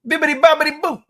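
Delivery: high-pitched playful chant; sing-song it high and loud; playful nonsense. Pretend. Playful burst of mock-Italian nonsense.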